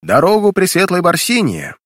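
loud, high herald's call; town crier. A narrator's reading. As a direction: announce it like a herald clearing the way. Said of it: "требовательно звонкий голос глашатая".

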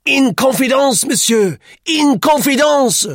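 put-on very high, shouted French-accented protest; indignant protest. A narrator's reading. Duclos protests to Roux, indignantly. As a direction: insist at the top of the voice.

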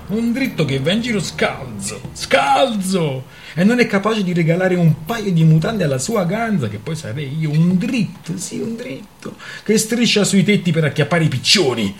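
high, loud scornful rant; derisive tirade. A narrator's reading. Kaska derides her lover's poverty, scornfully ranting. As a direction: throw his boast back at him.

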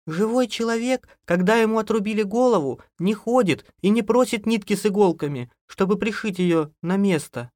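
high, overwrought woman's voice; nerves giving way. A narrator's reading. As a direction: protest high-pitched, nerves frayed. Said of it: "у бедняжки сдали нервы". (высоко, на нервах)

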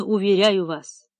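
plain reading voice

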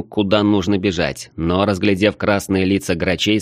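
plain reading voice

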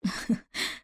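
breathy unvoiced chuckle; a quiet huff of a laugh; soft laugh. A narrator's reading. Mohamed laughing at his friend's question, softly. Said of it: "ضحك محمد وهو يهز رأسه".

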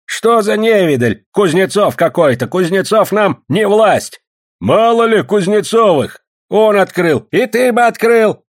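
high, loud scornful protest; indignant defiance. A narrator's reading. Prospector rejecting Kuznetsov's power, indignant high protest. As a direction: scoff loudly, voice pitched high.

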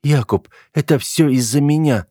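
high female voice, mildly tearful; whimpering. A narrator's reading. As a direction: plead with a light, understated sob. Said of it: "всхлипнула она". (слегка плаксиво, высоким голосом)

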